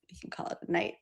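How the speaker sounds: whispered aside, unvoiced. Real, not acted. Host whispering to co-host about ending stream, softly. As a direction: breathe it out softly as a sign-off.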